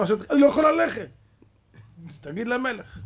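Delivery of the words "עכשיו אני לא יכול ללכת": quick, high, loud complaint; protesting yelp. Acted. Broken-backed Haman refusing to walk, high quick protest. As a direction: blurt it out high and fast.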